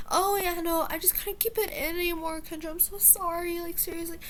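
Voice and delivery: high-pitched imitation of an apologizing friend; mock apology. Storyteller mimicking the friend's apology to Kendra, mocking.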